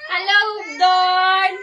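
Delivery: loud, drawn-out hello; big, slow greeting call. Real, not acted. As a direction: sing it out slowly and loudly to a relative. Greeting relative on video call.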